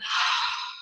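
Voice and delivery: long voiceless sigh, releasing all the air; audible exhalation. Shown as a demonstration. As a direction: demonstrate a full, breathy exhale. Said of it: "Exhale, let it all out".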